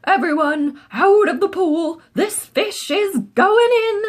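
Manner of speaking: high, loud firemen's yell. A narrator's reading. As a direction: clear the pool with a shout. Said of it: "The firemen yelled".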